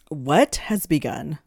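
slow, loud and pressing; demanding. A narrator's reading. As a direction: demand an answer sharply. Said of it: "Tali demanded".

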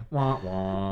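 sad trombone wah wah sung loud; mock losing horn. After a wrong answer on a quiz show, a loud mock sad trombone. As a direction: play the fail sound for a lost game show round. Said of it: "And I'd be like".

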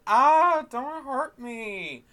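high-pitched hammed-up damsel panic; mock-frightened falsetto plea; mock coy. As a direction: squeal it like a fake scaredy-cat. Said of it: "being a little bit coy".

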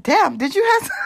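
loud, high laughing exclamation; laughing shout. Retold or imitated. Friend laughing at her over the hot sauce. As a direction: shout it through a burst of laughter.

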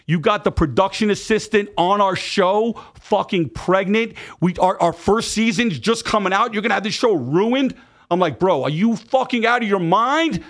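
retold high-pitched furious tirade; incredulous, emphatic rant; outraged disbelief. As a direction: lay into a friend in stunned outrage, voice pitched right up. Acted furious reaction to pregnancy news.

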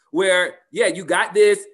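loud, cheering someone on; mock pep talk. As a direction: pump them up like a cheerleader.

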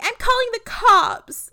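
high, loud imitation of wailing; mock scream-crying. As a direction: wail it like a hammed-up crybaby. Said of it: "Lee starts wailing and scream crying".

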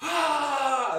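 long, high, very loud scream; charging war cry at full voice; imitated attack scream. Strangers charging at the campers, imitated scream.

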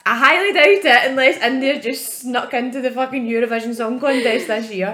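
sarcastic, loud dismissal; sarcastic retort. Retold or imitated. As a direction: scoff at the idea. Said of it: "I was like".

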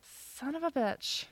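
slow, soft, under the breath; muttered curse. Retold or imitated. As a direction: mutter it as the truth dawns. Realizing the name mixup.

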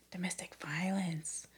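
hushed whispered repeat. Shown as a demonstration. Repeating why cops came, in a whisper. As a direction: whisper it as if it's scandalous.

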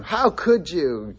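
high, drawn-out imagined scolding; mock reproach. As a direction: stretch it out as a pretend reproach. Imagined reproach God doesn't make.

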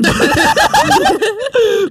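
high-pitched drawn-out laugh; laughter. Real, not acted. Laughing at a friend's shady history, high helpless laughter. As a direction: burst out in a long, high laugh.

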